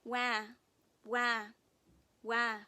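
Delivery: loud mock baby wails; baby crying wah. Narrator voicing the newborn crying in the crib, loud mock wails. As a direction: wail like a newborn. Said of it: "said the baby".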